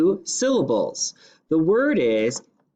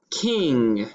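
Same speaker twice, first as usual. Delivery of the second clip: ordinary delivery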